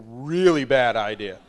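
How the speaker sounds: loud, slow stern warning; emphatic warning. Retold or imitated. Uncle warning niece off Thanksgiving, loud and slow. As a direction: lean hard on each word.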